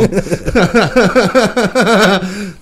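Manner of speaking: high, loud laughter; laughing again. Real, not acted. Friend still cracking up over the nipple weights story, loud laughter. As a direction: keep the laughter rolling.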